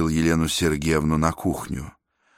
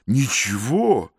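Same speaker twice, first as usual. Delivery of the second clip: stern; 5 semitones higher, slower than usual. Acted. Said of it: "строго сказал Мордотенков".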